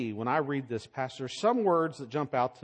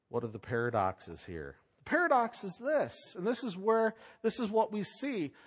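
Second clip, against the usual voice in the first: ordinary talk, nothing marked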